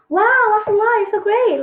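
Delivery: high, bright-eyed gushing wow; mock-amazed "wow, mashallah"; excited praise. Shown as a demonstration. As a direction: gush with delight. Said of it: "just like saying".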